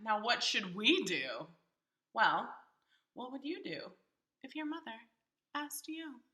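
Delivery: ordinary delivery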